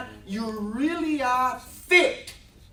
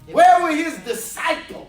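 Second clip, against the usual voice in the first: louder and higher, hammering the crowd; preaching shout; 8 semitones higher, 9 dB louder than usual. Acted. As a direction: call out the missing men. Preacher at full force.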